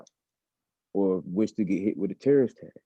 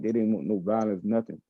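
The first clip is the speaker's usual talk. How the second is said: ordinary delivery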